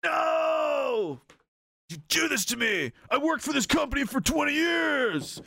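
high, desperate shouted protest; hammed-up betrayed yell; acted shout. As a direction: howl it like a wronged employee. Betrayed man shouting in skit.

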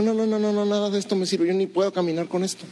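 lightly voiced boy; refusing young David. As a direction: push the armor away.

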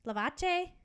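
normal talking voice

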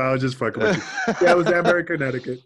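loud, amused admission; laughing. Real, not acted. Guest admits he was just kidding the host, laughing. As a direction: talk on through the tail of laughter. Laughing after teasing host's accent.

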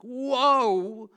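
loud high amazed whoa. Retold or imitated. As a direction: gasp out the wonder. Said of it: "It was like".

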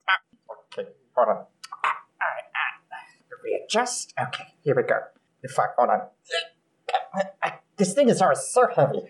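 soft, broken muttering under effort; fumbling struggle. Acted. Goblin struggling under his fallen cloak, quiet fumbling. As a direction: fumble along quietly in short bursts, as if wrestling with heavy cloth.

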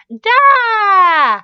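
put-on loud, high, drawn-out baby squeal; high-pitched toddler shout; near squeal. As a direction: squeal it out long like a delighted baby. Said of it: "Cassie all but squealed".

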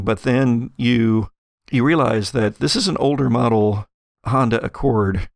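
ordinary talk, nothing marked

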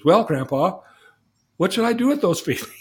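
put-on high, loud, fast kid voice breaking into a laugh; a boy blurting out his question to grandpa, half laughing; eager boy. Boy brings it up again.

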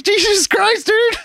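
high, exasperated jab at a friend; mocking a friend's sudden swagger, high exasperated; mock exasperation. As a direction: throw it up high in disbelief.

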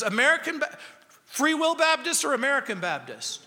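high, quick, excited questions; mock-excited high quizzing; eager curious. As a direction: pounce on the detail. Rescuer quizzing denominations.

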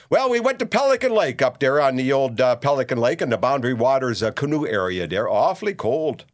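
lightly put-on Minnesota accent. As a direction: play the Fargo-style Minnesota talk show host.

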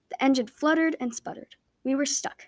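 ordinary delivery

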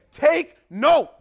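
loud, forceful command; emphatic. Retold or imitated. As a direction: bark it out like an apostle's warning. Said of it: "he says it with it emphatically".

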